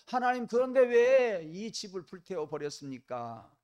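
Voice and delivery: loud, high, desperate complaint to God; anguished cry to God. Acted. Stranded man crying out to God after his house burned, loud and high. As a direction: shout it up at heaven, aggrieved and pleading. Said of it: "원망의 소리를 질렀습니다".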